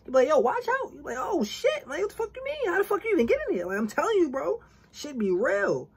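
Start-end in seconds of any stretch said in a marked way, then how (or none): 0.3-0.9 high startled yelp; pitched-up mock alarm. Imagined startled reaction. 1.2-3.7 high, startled mock outrage; pitched-up hammed-up disbelief; mock shock. Acted shock at stranger.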